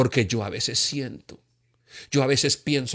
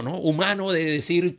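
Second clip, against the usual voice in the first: usual speaking voice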